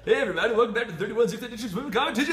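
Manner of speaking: big, high, loud morning radio host. Pretend. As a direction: boom it out like a morning DJ. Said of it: "my radio morning D J voice".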